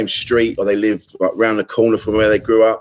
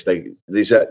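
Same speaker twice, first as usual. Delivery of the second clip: ordinary talk, nothing marked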